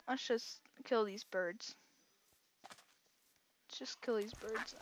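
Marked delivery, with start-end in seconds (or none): 3.7-4.7 soft whispered aside; hushed, voiceless repeat to yourself. Real, not acted. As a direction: whisper it under your breath. Repeats plan under breath.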